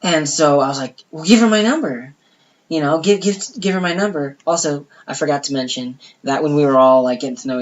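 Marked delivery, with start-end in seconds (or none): 1.1-4.4 eager urging. Retold or imitated. Eager urging, repeated words.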